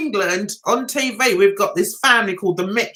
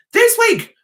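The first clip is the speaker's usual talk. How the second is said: high, punchy vlogger imitation; mock vlogger intro; 10 semitones higher, 4 dB louder than usual. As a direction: announce it like an overexcited vlogger.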